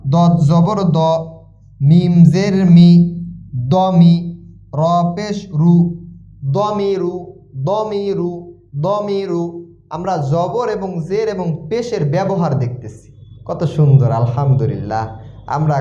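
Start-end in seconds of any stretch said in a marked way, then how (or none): 0.1-9.7 slow, measured syllable-by-syllable chant; spelling drill recitation. Shown as a demonstration. Teacher modelling Quran spelling for learners, drawn-out drill chant. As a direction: draw out each syllable on a level pitch.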